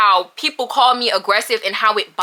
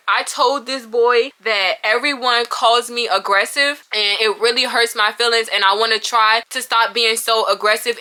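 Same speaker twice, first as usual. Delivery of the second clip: ordinary delivery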